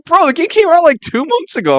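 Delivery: retold protest, very high and loud; incredulous retort. Podcaster retelling his comeback to the sandwich guy, incredulous. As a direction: act out loud, high-pitched disbelief.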